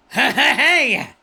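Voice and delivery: loud, high clown yell; Krusty the Clown. Acted. Shouting hey at chat as Krusty. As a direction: bark it like a jaded cartoon clown. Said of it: "Krusty the Clown".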